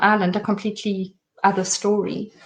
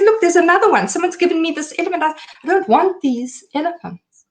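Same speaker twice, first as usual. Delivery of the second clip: a retold desperate rant, pitched up; frantic distraught babbling; 8 semitones higher than usual. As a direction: rattle on like it makes no sense. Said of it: "Nothing I was saying to her was making sense".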